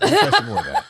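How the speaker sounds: very high, put-on imitation voice; hammed-up high mimicry; generic somebody-else voice. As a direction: imitate someone begging in a squeaky falsetto. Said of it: "whenever I do that voice".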